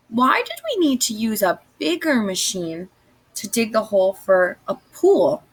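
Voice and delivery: ordinary talk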